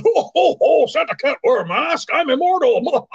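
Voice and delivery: high, booming ho ho ho; jolly Santa. Pretend. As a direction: play a cheery Santa laughing it off. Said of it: "I walk in".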